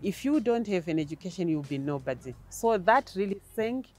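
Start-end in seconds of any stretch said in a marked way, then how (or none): none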